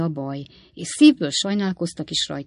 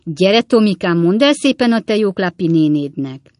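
put-on louder, sing-song coaxing of a child; cajoling; 2 semitones higher, 8 dB louder than usual. A narrator's reading. Klappinsné begs Tommy to tell auntie, cajoling. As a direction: wheedle with big, eager sweetness. Klappinsné coaxes the boy again.

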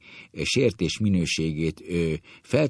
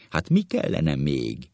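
ordinary delivery

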